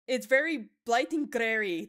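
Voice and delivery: quoting the blunder. Retold or imitated. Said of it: "That's what I said".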